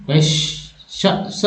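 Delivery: soft unvoiced श and स sounds; sound demo. Shown as a demonstration. Teacher testing श against स for students. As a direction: breathe the श and स hushed to compare. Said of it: "स नहीं".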